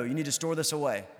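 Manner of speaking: usual speaking voice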